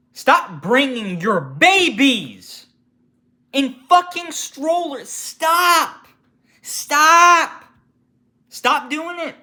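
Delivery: high, loud, furious yelling; angry shout. Real, not acted. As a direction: scream the demand at them. Ranting at parents with strollers.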